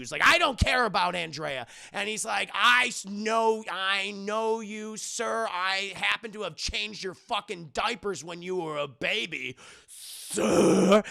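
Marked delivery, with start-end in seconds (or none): 0.2-1.7 loud mock-angry retort; acting out a heated denial; irritated outburst. Acted quarrel between Bruce and Alfred. 2.5-11.0 emphatic, drawn-out mock rebuke; hammed-up indignant scolding; indignant butler. Alfred scolds Bruce in quarrel.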